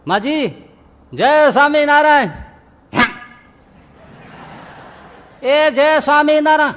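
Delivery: put-on shouting a greeting to a deaf old woman; calling out. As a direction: call across the street to her.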